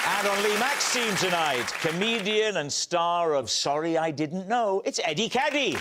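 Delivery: upbeat TV host introduction; show host intro. Acted. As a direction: announce the guests with energy.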